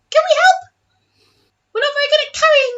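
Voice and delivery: high, fast tiny creature voice; pixie. Acted. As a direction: squeak it like a little critter. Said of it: "you hear a little".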